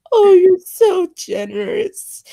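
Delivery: high, slow and teary-sounding; gushing girlfriend voice. Pretend. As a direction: swoon over him like a cartoon girl. Playing Daisy answering Ryan.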